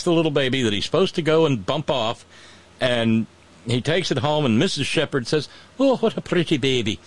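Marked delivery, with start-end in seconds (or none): 5.8-7.0 high, cooing Irish shepherd's wife; Irish accent. Acted. Shepherd's wife seeing the foundling baby, Irish accent. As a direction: coo it in a put-on Irish accent. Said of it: "she's got an Irish accent".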